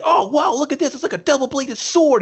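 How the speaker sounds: put-on high-pitched, wowed little kid; imitating amazed kid. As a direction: marvel at it like an excited child. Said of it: "the kid should have been like".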